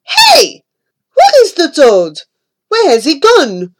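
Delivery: high startled yell; startled shout. A narrator's reading. Frenchmen crying out when the toad vanishes, loud startled shout. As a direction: shout it, suddenly baffled.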